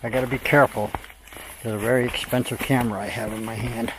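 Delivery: normal talking voice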